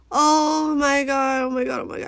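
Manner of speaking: retold flood of relief; overwhelmed relief. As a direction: gush it, stretching the first one out. Retold overwhelmed thanks at door.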